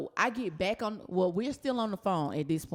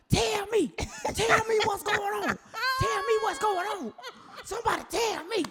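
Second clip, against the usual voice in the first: desperate pleading; 13 semitones higher than usual. Retold or imitated. Kendall demanding to be told, high frantic pleading. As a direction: beg them to tell you, shouting. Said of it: "She was like".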